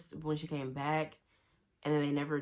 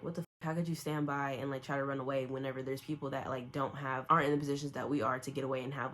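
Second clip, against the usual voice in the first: usual speaking voice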